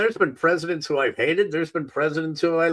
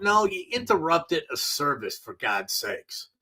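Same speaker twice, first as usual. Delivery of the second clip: normal talking voice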